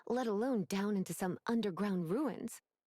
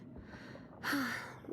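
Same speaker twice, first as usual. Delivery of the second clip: a long breathy sigh that falls away; soft tired exhale trailing down; weary sigh; 3 semitones higher, 5 dB quieter than usual. Acted. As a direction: let the breath out like the work is a burden. Lisa tired of her work.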